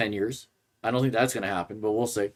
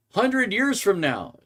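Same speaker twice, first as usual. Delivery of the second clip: mocking quote; 9 semitones higher, slower than usual. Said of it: "I'm quoting here".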